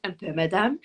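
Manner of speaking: a different voice for the maid, answering humbly; maid Alice in French. Acted. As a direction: switch to the lady-in-waiting, modest reply. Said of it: "I was totally doing both parts then".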